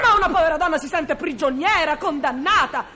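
wife shouting down her husband's supposed jealousy, indignant; angry outburst. Acted. Wife escalating jealous-quarrel accusations.

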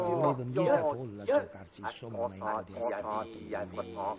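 recitation dropping into a murmured stretch. Acted. Recitation continues.

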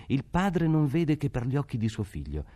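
usual speaking voice

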